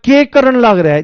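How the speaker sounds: imitating a Haryanvi student asking; Haryanvi accent. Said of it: "उन्होंने कहा".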